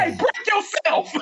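slow, high robber shout; mock stick-up shout. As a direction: bark it like a stick-up, laughing. Acted stick-up drive-by hug.

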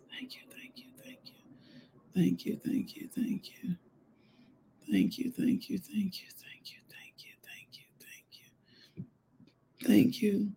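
hushed, breath-only thanks to God; soft whispered praise; whispered prayer. Real, not acted. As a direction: murmur thanks under your breath, over and over.

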